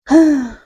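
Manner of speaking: nervous loud sigh. Real, not acted. About to see exam result.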